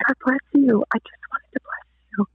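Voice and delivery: softening to a whisper; acted tender, emotional reassurance; tender blessing. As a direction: bless them gently, fading into breath. Tender reply to a weeping woman.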